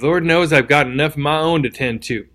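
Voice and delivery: usual speaking voice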